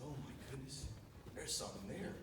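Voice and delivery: soft, hushed gasp of surprise; whispering to himself in the blind; hushed excitement. Retold or imitated. Hunter alone in the blind, softly amazed. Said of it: "I said".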